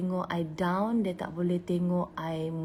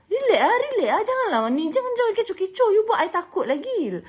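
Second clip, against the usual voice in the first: much higher, louder, fast flustered voice; panicky husband; 12 semitones higher, 6 dB louder than usual. Retold or imitated. Husband begging his wife not to make a fuss. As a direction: flap about, begging her to calm down. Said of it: "Laki I cakap macam tu".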